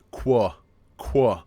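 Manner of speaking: QUA said as kwa, with a Q sound; sound demo. Shown as a demonstration. Teacher models the Q sound for learners, clear demo. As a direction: say QUA clearly as one kw syllable. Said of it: "which is pronounced".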